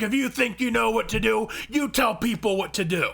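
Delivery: high, loud angry yelling; shouting match. Retold or imitated. Angry gamer arguing over who gives orders, shouting. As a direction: shout back like a fed-up gamer. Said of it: "whole fucking shouting match".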